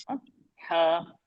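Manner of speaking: usual speaking voice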